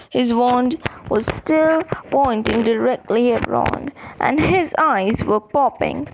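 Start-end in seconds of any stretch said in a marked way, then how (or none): none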